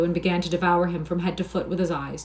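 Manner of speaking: plain reading voice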